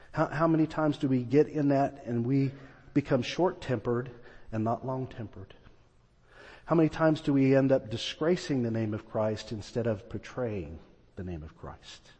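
ordinary talk

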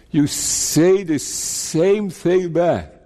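usual speaking voice

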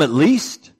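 ordinary talk

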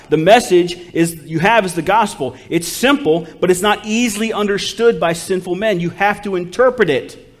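loud, insistent sermon delivery; forceful preacher laying down doctrine; emphatic preaching. Real, not acted. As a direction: press the argument on the congregation.

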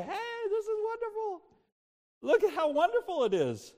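high-pitched awe, stretching the first word; amazed delight. Pretend. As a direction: exclaim it like you just arrived in paradise. Imagined cry on reaching heaven.

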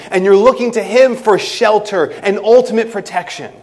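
full-voiced sermon delivery; preaching. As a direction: press the illustration home to the congregation.

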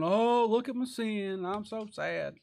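slow, high, drawn-out mock wail; hammed-up sorrow, stretched out; mock lament. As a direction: moan like a showy penitent. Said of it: "walk around wearing hair suits going".

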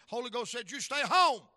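preacher on the phone insisting, firm and loud; emphatic insistence. Retold or imitated. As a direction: lay down the law.

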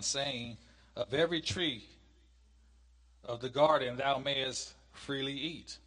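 loud, measured Bible reading; scripture reading. Acted. Teacher reads God's command from Genesis to class, scripture reading. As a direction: read it out slowly, with weighty stresses.

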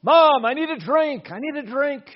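loud, high-pitched shout for Mom; thirsty child calling. Retold or imitated. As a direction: cry out urgently like a thirsty kid. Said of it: "he burst into that trailer and he said".

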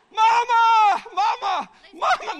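high, loud repeated calls; excited yells for mama. Acted. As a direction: shout for her again and again. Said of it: "Come on, do it".

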